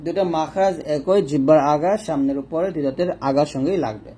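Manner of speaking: ordinary talk, nothing marked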